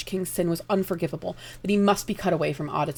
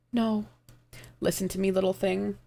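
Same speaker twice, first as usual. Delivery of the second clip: ordinary delivery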